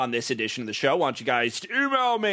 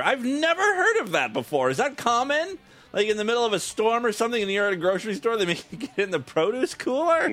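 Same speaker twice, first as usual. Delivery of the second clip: high-pitched, amazed questioning; incredulous; 6 semitones higher than usual. Real, not acted. Host reacting to a caller's cooler story, incredulous. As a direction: react in laughing disbelief, pitch jumping up.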